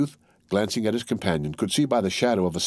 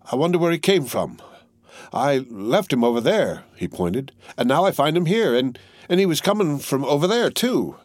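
higher, bewildered; puzzled youth; 6 semitones higher than usual. Acted. Youth wonders aloud where the body came from, puzzled. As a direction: point this way and that, confused.

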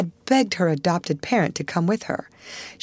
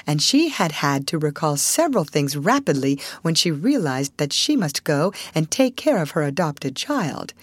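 usual speaking voice

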